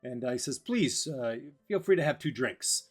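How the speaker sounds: high, loud flowery farewell; bow out with a grand flourish; theatrical gentleman. Acted.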